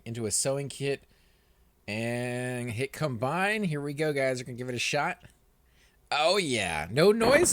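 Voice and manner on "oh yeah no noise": surprised. Real, not acted. Item combine surprise, no sound.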